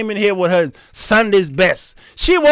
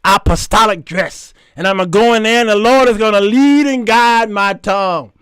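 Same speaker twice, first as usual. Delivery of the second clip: rising, louder mock-preaching delivery; church testimony; 3 semitones higher, 5 dB louder than usual. Woman telling church of her mission, fervent mock testimony. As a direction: build it up like a testifying church mother. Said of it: "I testified at church".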